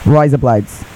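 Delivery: "Razor blades" in Australian, as RYE-zuh blites; a light Australian drawl on "Razor blades"; Australian accent. Retold or imitated. Friend imitating an Australian saying razor blades, Australian accent. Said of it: "an Australian saying razor blades".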